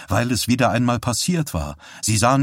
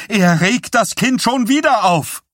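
very high, loud, frantic demand; almost hysterical scream; 13 semitones higher, 4 dB louder than usual. A narrator's reading. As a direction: shriek it as a distraught mother. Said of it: "schrie Pattys Mutter beinahe hysterisch". (beinahe hysterisch geschrien)

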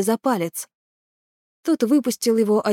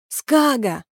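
calling out; 5 semitones higher than usual. Acted. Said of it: "закричал Нааль".

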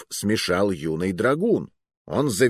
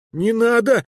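high, loud squeaked plea; frightened squeak; 13 semitones higher, 5 dB louder than usual. A narrator's reading. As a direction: squeak it out, begging for your life. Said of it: "пискнул я". (испуганный писк)

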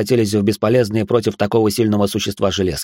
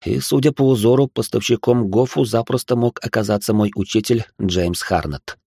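ordinary delivery, nothing marked

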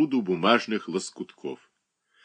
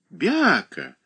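put-on high and loud little girl; a toddler scolding her mother; spitting child; 10 semitones higher, 5 dB louder, much slower than usual. A narrator's reading. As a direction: spit the words like an angry toddler. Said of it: "плевалась девочка".

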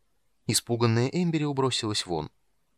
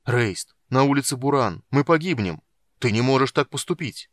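loud, raised-pitch pleading; urgent appeal; 3 semitones higher, 6 dB louder than usual. A narrator's reading. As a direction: call out, begging him to stay. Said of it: "взывал Карамон". (громко взывать к брату)

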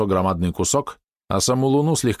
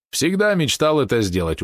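slower, higher, gloating delivery; smirking; 7 semitones higher than usual. A narrator's reading. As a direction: savour it with a smirk. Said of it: "ухмыльнулся Грур". (медленно, с ухмылкой)

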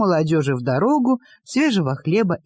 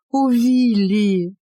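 slow, high, pleading cry; plaintive plea; 5 semitones higher, 3 dB louder, much slower than usual. A narrator's reading. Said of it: "жалобно взмолилась молодая женщина".

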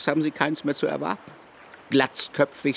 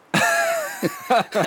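mocking laugh. Investigator mocks the suspect.

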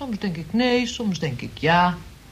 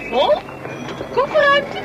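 very high, loud astonished question; baffled; 5 dB louder than usual. Acted. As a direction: squeak it out in disbelief. Baffled the boot is full. (hoog en luid verbaasd)

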